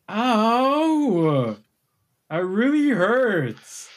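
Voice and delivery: high, slow whiny ogre wail; hurt ogre. Acted. Ogre complaining to the barbarian, high slow whine. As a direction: draw out the pain, high and slow. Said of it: "he's like".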